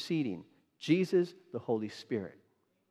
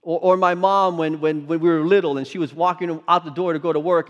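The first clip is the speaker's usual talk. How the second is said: usual speaking voice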